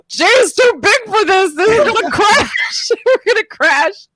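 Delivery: half-laughing retold fear on the Ferris wheel; retelling panic at the top of the Ferris wheel; mock panic. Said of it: "and I was like".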